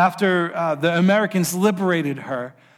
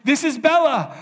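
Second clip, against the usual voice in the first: high, repeated this is Bella, lightly voiced as if in tears; a husband overcome on the phone, mildly imitated; tearful repeating; 9 semitones higher than usual. Husband weeping to his lost wife on the phone: lightly imitated tearful, high voice. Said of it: "he's just in tears".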